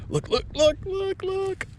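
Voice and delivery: very high, rapid-fire repeats; excited squeal. Real, not acted. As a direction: squeal each word, urging viewers to see. Urgently showing viewers the find.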